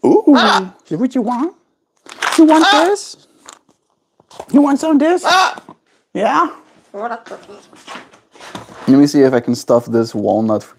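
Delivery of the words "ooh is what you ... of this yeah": very high cooing questions; squeaky pet talk. Acted. Offering pet bird a treat, very high cooing voice.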